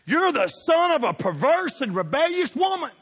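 high, loud furious accusation; Saul's anger. Acted. King Saul raging at his son Jonathan, loud and furious. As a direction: hurl the insult at your son. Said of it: "Saul's anger flared up at Jonathan".

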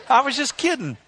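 high-pitched playing it off; hammed-up "only joking" backpedal; mock excuse. Honker's excuse, exaggerated by pastor.

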